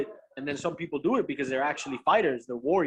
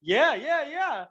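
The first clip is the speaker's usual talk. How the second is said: high, sing-song placating; humoring agreement; 8 semitones higher, much slower than usual. Retold or imitated. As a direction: re-enact agreeing just to keep him happy. Acts out insincere agreement to fighter.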